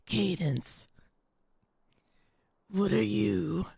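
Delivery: slow, put-on low gravelly male voice; low grovelly voice. Mocking the narrator's male character voice, low and gravelly. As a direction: growl it out like the narrator's guy voice. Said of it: "low, grovelly voice".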